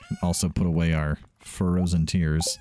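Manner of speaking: normal talking voice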